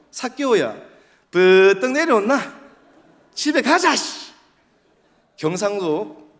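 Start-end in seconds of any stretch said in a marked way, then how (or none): 0.1-4.3 loud, high Daegu dialect; bossy call in Daegu dialect. Shown as a demonstration. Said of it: "대구 말씨로".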